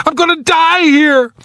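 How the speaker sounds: high-pitched panicked wail. A narrator's reading. Trapped man fears dying in the ice, panicked. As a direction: despair in a high, frantic voice. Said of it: "Now there was panic".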